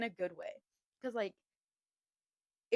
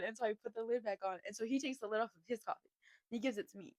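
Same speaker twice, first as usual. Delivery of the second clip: usual speaking voice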